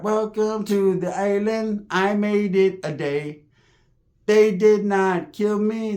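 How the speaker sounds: hammed-up ceremonial island chant; islander welcome. Imagined island voice in his head.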